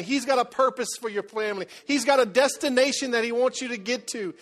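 preaching at full force. Acted. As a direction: preach it out to the congregation with rising fire.

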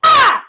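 sharp loud shriek of fright; startled scream. Real, not acted. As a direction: jump and scream. Sudden scare while watching.

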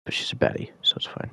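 quick joking whisper. Real, not acted. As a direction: whisper it as a cheeky aside. Joking aside about the character.

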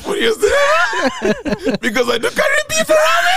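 high, squealed words through laughing; real hysterics, voice climbing to a squeal; helpless laughter. Real, not acted. Comedian cracking up at his friend's beef joke, squealing with laughter.

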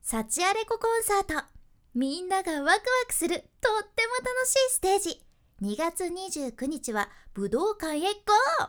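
high, loud, upbeat announcer read; bright narration read. Shown as a demonstration. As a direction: sell the concert with excitement. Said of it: "いきますよ".

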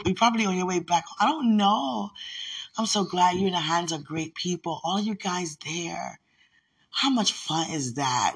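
ordinary delivery

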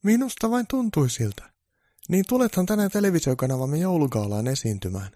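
high producer voice inviting; producer's high eager invite. Acted. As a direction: sell the gala invitation.

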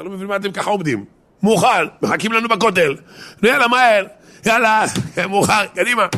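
high, loud urging to get moving; urgent hurry. Retold or imitated. Baba Sali hurries everyone to leave for the Kotel, loud and urgent. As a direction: rush them out the door, they're late.

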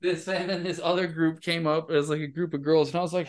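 ordinary delivery

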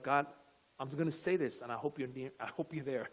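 low, very soft uncertain prayer; quiet desperation. Acted. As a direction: pray under your breath, barely hoping. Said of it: "like a place of desperation".

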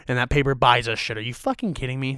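ordinary talk

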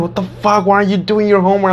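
high, scolding mock rant; imitating classmates snapping at him; mock angry cussing. Classmates cussing him out over homework, high mock anger. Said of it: "They would start cussing like".

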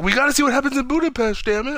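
very high, strained mock voice; hammed-up outburst. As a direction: rattle it off in a high put-on voice. Acted outburst quoting the film.

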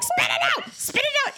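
very high, urgent retold cry; panicked parent. Mother getting something out of her son's mouth, high-pitched panic. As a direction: squeal it urgently, voice leaping way up. Mother slapping child to spit.

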